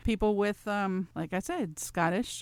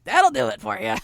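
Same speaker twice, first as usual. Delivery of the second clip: usual speaking voice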